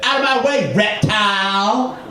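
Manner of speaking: high, drawn-out mock yell; angry command. Woman with her hand in his face, mock-angry. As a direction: imitate an irate shopper ordering someone aside. Woman snaps at him in store.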